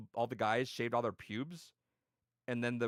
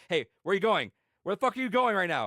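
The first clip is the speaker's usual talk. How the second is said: loud, higher indignant questioning; retold angry demand; 7 semitones higher, 8 dB louder than usual. Prank victim confronting the driver, loud indignant demand. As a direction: demand answers in a raised voice.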